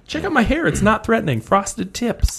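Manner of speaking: high-pitched put-on creep voice; high, hammed-up weirdo sales pitch; mock creeper. Playing the creeper with the van.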